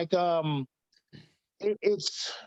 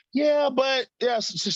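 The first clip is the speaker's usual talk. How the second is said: put-on high, louder childish objection; mock protest; 9 semitones higher, 4 dB louder, slower than usual. Son objects to dad about taekwondo, mock protesting. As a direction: imitate a kid starting to argue.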